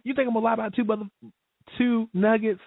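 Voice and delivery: mock outrage, high and rapid; high, fast outrage. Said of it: "I'm like".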